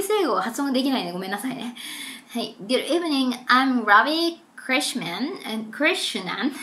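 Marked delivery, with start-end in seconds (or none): 2.6-6.5 slow, careful newsreader read; lightly voiced newscaster intro; news announcement read. Reads news script aloud.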